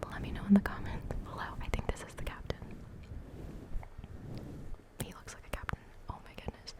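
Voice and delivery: soft ASMR whisper; breathy whispered chat, close to mic. Real, not acted. Whispers throughout the video.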